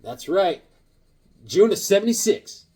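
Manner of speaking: ordinary talk, nothing marked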